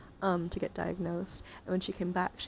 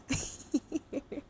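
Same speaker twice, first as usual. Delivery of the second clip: high light giggle; short laugh; 7 semitones higher than usual. Real, not acted. Streamer admitting two hours just happened, high giggle.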